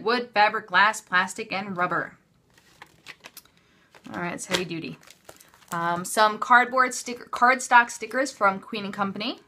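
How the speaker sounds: usual speaking voice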